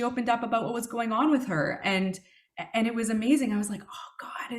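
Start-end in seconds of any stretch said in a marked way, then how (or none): 3.8-4.6 breathless little whisper to God; whispered prayer. Retold or imitated. Prayer of thanks in her head.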